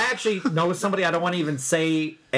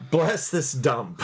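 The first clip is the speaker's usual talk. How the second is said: slow quote; 2 semitones lower, much slower than usual. Retold or imitated. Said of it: "what did the shirt say".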